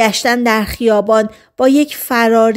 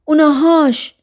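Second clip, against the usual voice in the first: shrieking; 5 semitones higher than usual. A narrator's reading. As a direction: cry it out, pointing someone out in alarm. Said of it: "جیغ زد".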